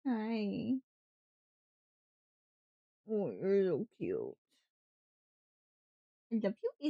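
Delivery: ordinary delivery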